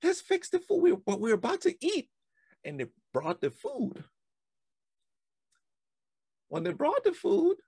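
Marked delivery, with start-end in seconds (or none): 0.0-2.1 much higher, louder excited voice; flustered villagers rushing to cook; fussy townspeople. Retold or imitated. Townspeople rushing to feed the boy, high excited fuss. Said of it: "the townspeople were like".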